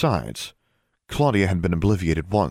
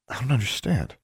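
stunned; 6 semitones higher than usual. A narrator's reading. Shocked at the returned pack.

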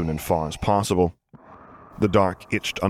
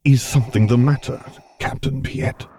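light Vader voice; 6 semitones higher, 4 dB louder than usual. Acted. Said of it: "he asked as lightly as the vocoder would allow".

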